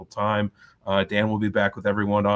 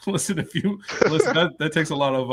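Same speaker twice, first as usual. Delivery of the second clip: laughing; 6 semitones higher than usual. Real, not acted. Host laughing while speaking.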